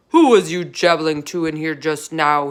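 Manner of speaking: loud giant boom. A narrator's reading. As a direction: boom it down at him. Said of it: "he boomed".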